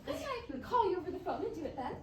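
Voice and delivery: ordinary talk, nothing marked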